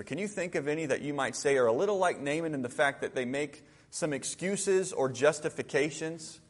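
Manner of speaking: ordinary delivery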